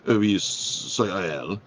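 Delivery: slow mock French waiter; French accent. French waiter admits it to the diner, slow French accent. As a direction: drag out the admission in a French accent. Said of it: "So the waiter goes in this French accent".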